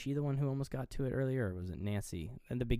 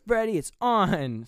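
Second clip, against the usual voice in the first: higher, drawn-out mock shout; shouted challenge; 9 semitones higher, 8 dB louder, slower than usual. Imitating heroine challenging the villain to fight, shouting. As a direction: imitate her slow, loud battle call.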